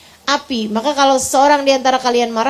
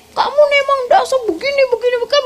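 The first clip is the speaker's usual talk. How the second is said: mock angry husband; 11 semitones higher, faster than usual. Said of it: "kata-katanya nyakitin".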